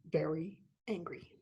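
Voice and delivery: dramatic hushed whisper. A narrator's reading. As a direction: drop to a secret whisper. Storyteller whispers for emphasis.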